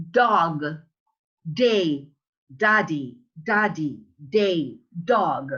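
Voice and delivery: dog, day, daddy with a firm tongue-tip D; each D word said separately, falling; alveolar D. Shown as a demonstration. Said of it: "the tip of the tongue here".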